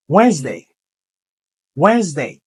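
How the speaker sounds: ordinary delivery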